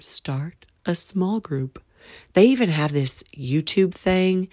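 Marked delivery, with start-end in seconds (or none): 0.0-1.8 slower and softer echo; quiet drawn-out echo; slow soft repeat. Shown as a demonstration. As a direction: let the repeat sink in slowly. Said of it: "Maybe you need to start".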